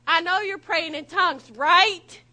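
mock-alarmed, forceful question; pointed passenger check. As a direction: call it out, hitting "right" hard. Passenger challenges the tense driver.

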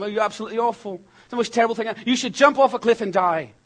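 lightly voiced brutal talent judge; Simon Cowell impression. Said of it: "That's my terrible impersonation".

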